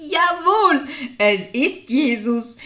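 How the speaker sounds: big, loud, drawn-out announcement; loud exclamation. A narrator's reading. Martha can't hold back the news, loud exclamation. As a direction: burst out with the news at full voice. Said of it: "rief sie laut".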